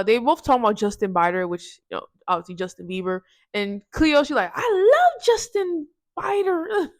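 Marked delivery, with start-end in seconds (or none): 4.5-6.9 high, slow, swooning gush; gushing fangirl. Retold or imitated. [name] gushing to the group about her crush, high and swooning. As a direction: swoon over the name like a starstruck fan. [name] gushes over [name].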